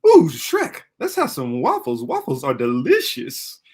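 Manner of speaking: hammed-up cartoon sidekick; Donkey. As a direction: burst in loud, then bounce along. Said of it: "I used to be able to do Donkey".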